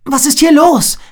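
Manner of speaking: high, loud alarmed shout; scream. A narrator's reading. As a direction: yell it in sudden alarm. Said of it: "schrie Agila". (lauter, hoher Schreckensschrei)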